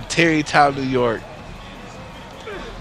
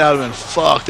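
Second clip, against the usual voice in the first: ordinary talk, nothing marked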